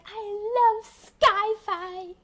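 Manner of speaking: high, drawn-out mock gushing; gushing imitation. Kid imitating someone who adores Sci-Fi, gushing. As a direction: stretch it out high, imitating a gusher. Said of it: "She's like".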